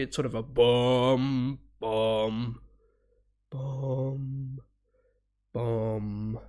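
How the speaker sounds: slow mock bass notes, bum bum. Imitating the orchestra's low notes.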